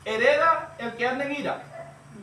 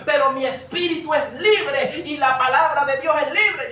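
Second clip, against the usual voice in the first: louder, driving sermon voice, some words slowed; preaching at full force; 4 dB louder than usual. Acted. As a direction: preach it out to the congregation. Predica con toda fuerza. (predicación a toda voz, más fuerte)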